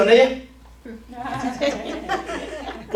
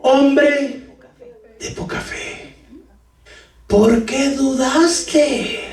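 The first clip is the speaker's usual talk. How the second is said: a hint of a gentle, half-laughing rebuke, ending very slow; Jesus rebuking; 2 semitones higher, much slower than usual. Jesus to sinking Peter, lightly voiced rebuke. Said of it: "y le dijo".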